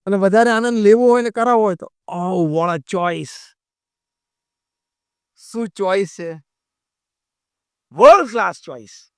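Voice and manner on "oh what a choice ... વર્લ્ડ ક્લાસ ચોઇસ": slow, drawn-out show of admiration; gushing mock praise. Shown as a demonstration. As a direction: lay on the compliment, savouring each phrase. Coaching husbands to praise curtains.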